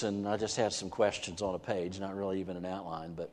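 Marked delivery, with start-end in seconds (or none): none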